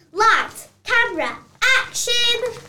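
loud, rising director's cue; calling action. Acted. Director restarting after scolding, loud call. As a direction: shout the cue to roll.

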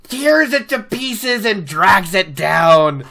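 dramatic storytelling. Acted. Dramatic retelling of whirlpool.

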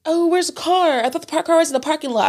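high, fast mock of clueless friends. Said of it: "they're like".

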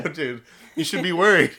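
loud joking voice. Retold or imitated. Joking reaction quote.

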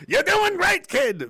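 high, loud goofy cheer; stupid voice. Acted. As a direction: shout encouragement in a dopey cartoon voice. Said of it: "the stupid voice".

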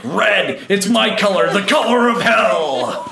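playing Satan gleefully. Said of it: "Satan was like".

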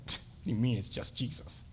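soft, low-key mock objection; listener's doubting thought. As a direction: voice their silent doubt under your breath. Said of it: "Some of you are already thinking".

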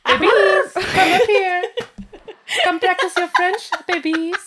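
high, laughing pet voice. Real, not acted. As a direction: coo at the cats. Calling cats over.

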